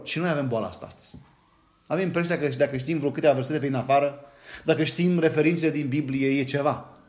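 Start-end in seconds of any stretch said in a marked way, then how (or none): none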